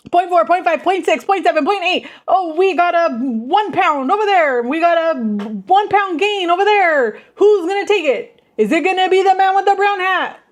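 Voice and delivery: fast rising auctioneer patter. Pretend. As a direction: call out bids like an auctioneer. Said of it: "That's what I feel like".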